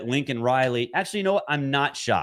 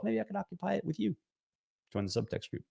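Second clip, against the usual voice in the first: flirty whisper; 8 semitones higher, 13 dB quieter, faster than usual. Pretend. Flirty pitch for text group.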